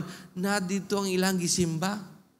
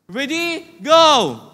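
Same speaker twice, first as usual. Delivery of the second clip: slow stressed "loud voice", then shouted "go"; preacher rallying the crowd, loud; 4 semitones higher, 12 dB louder, much slower than usual. Shown as a demonstration. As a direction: build to a shout. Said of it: "saying with a loud voice".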